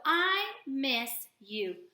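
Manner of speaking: "I miss you." drawn out word by word; very slow read. Shown as a demonstration. Teacher models reading to the class, very slow. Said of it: "modelling slow read".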